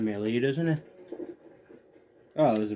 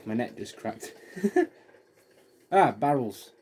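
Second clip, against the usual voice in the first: ordinary talk, nothing marked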